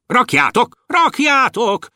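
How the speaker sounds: high-pitched storekeeper's call; urging. A narrator's reading. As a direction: hurry them along in a high voice. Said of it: "Sürgette őket a raktáros törpe". (magas hangú, sürgető raktáros)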